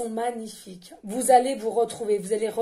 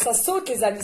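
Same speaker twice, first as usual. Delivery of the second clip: ordinary delivery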